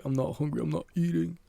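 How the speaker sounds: teary refusal. Retold or imitated. Boy refusing supper to his mom, tearful and high. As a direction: push it out through rising tears. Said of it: "like, crying".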